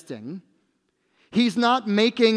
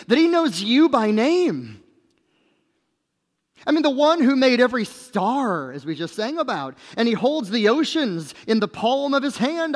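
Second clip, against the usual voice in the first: raised, swelling pulpit delivery; fervent preaching; 4 semitones higher than usual. Real, not acted. Preacher extolling the wonder of this king, fervent. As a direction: proclaim it with mounting wonder.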